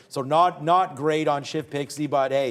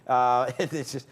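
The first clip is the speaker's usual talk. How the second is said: ordinary delivery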